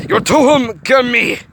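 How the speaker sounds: high, loud alien outburst; alien snapping. A narrator's reading. The Gorg snapping mangled words at Hiro. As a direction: snap the alien words at him. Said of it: "the Gorg snapped".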